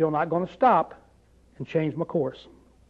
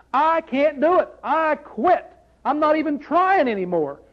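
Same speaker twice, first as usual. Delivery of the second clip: higher, pleading surrender; giving up; 7 semitones higher, slower than usual. Retold or imitated. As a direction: cry out that you're done trying. Confessing surrender to God.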